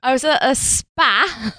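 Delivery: drawn-out Northern "spa", pitched high; put-on Northern vowel, slow and exaggerated; mock Northern accent. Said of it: "'Cause I'm Northern".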